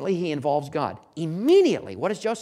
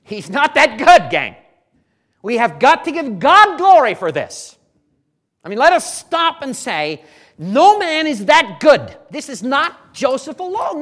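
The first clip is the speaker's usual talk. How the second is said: loud, high, fervent exhortation; forceful preaching; 13 semitones higher, 14 dB louder than usual. Real, not acted. Preacher urges giving God glory, fervent loud preaching. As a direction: drive it home to the congregation.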